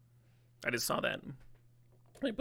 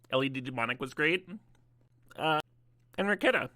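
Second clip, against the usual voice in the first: talking with mouth full; 4 semitones higher than usual. Real, not acted. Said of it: "Sorry, I'm talking with my mouth full".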